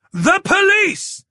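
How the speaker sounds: slow, high, loud shout over noise; emphatic shout into the phone; shouted back. A narrator's reading. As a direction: bellow each word so she's heard. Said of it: "Hatcher shouted back".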